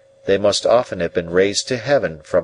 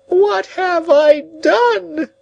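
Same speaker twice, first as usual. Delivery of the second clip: high, very slow wail; despairing cry; 5 dB louder, much slower than usual. A narrator's reading. Old woman on the ground lamenting, slow high wailing. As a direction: drag out each word in despair. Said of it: "returned to this cry over and over again".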